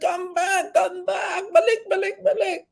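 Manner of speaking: high frantic cry to come back; desperate calling. Acted. Brother frantically calling the drowning boy back. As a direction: call out in panic, voice cracking high.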